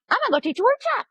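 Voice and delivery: hammed-up carefree announcement; exaggerated mimic of Sharon's breezy plan; mocking naive boldness. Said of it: "And she just went".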